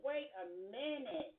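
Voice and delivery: ordinary talk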